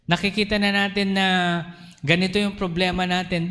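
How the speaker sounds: ordinary talk, nothing marked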